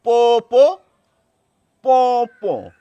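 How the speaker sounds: nasal পো পো like a horn; horn noise. Shown as a demonstration. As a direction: honk পো through the nose. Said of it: "এটা যত সহজে বোঝা যায়".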